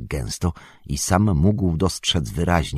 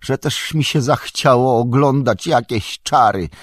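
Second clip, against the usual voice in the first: higher, louder upset wail of regret; distressed lament; 8 semitones higher, 5 dB louder than usual. A narrator's reading. Sam regrets ever wanting to see magic, distressed lament. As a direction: cry out in bitter self-reproach. Sam in despair after the vision.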